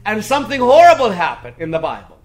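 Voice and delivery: high, emphatic sermon delivery; forceful preaching. Real, not acted. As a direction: hammer each phrase home from the pulpit. Preaches on changing the truth.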